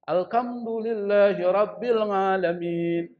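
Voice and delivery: put-on slow Javanese-accented recitation, "alkamdu" and "ngalamin"; logat Jawa. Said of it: "Kalau orang Jawa itu susah". (lantunan berlogat Jawa, ha jadi ka, ain jadi nga)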